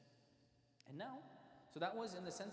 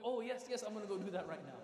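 eager retold compliance; 3 semitones higher, 7 dB louder than usual. Young servant answering the Prophet, eager. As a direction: act out a kid suddenly remembering an errand. Child servant hurrying to obey.

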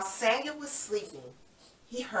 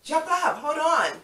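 excited urging; 8 semitones higher, 3 dB louder than usual. Acted. Urging children up.

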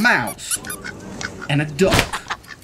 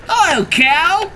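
high, slow and bright hello from Duck; cheerful duck greeting; 10 semitones higher, 4 dB louder, slower than usual. A narrator's reading. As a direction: sing out a friendly hello as Duck.

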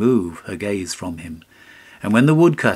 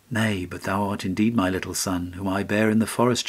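ordinary delivery

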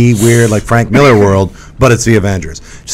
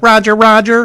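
droid voice; 10 semitones higher than usual. Retold or imitated. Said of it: "on those floating machines would be like".

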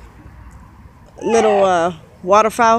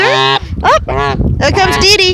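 excited high pet voice; 10 semitones higher, slower than usual. Real, not acted. Excited at goose arriving.

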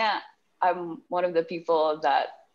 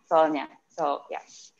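ordinary talk

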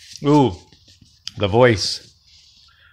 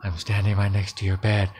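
low and soft, building dread; eerie whisper; 7 semitones lower, 6 dB quieter than usual. A narrator's reading. Strange voice stands by Ellen's bed, eerie whisper. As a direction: murmur it low, right at the bedside. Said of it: "Then it whispered".

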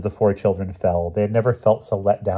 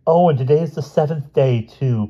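high, louder alarmed cry; cried out; 7 semitones higher, 4 dB louder than usual. Acted. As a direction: blurt it out in alarm. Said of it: "cried Jane".

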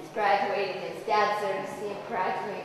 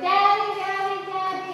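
high, loud cry of Daddy; children seeing their father home, crying out; excited child; 9 semitones higher, 5 dB louder than usual. Retold or imitated. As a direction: call out like a kid running to dad. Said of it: "the kids go running".

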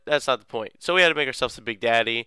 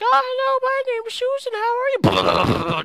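put-on little girl voice; 6 dB louder than usual. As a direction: squeak it like a tiny girl. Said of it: "I was like".

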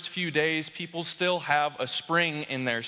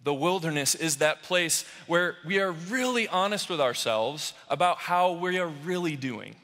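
normal talking voice

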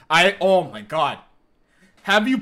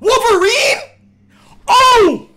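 high, loud, cracking with joy; ecstatic shouting; 12 semitones higher, 6 dB louder than usual. Real, not acted. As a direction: scream it out in pure hype. Wolverine game gets announced.

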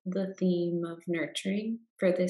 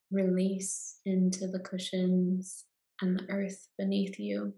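guided meditation. Acted. Guide leading listeners into Yoga Nidra rest, calm meditation voice.

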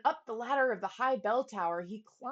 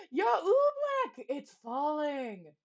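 high, loud slow cry of alarm; boy shouting warning to the king, then dropping; 5 semitones higher, 4 dB louder, slower than usual. Acted. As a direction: cry out the danger. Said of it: "he shouted".

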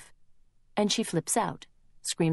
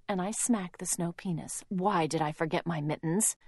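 ordinary delivery, nothing marked

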